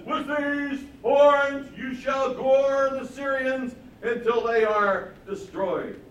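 loud, high, slow horn proclamation; enacted prophecy. False prophet speaking through horns, booming mock prophecy. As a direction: stretch every word like a pompous oracle. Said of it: "these horns that he speaks through".

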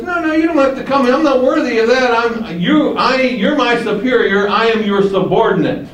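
high-pitched, hurried humble protest; protesting centurion. Acted.

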